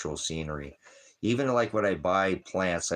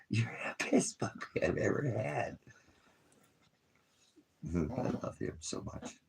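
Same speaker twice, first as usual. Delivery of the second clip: whispered praise to the dog; 4 semitones higher, 7 dB quieter than usual. Real, not acted. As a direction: breathe it softly to the dog. Softly praising his dog.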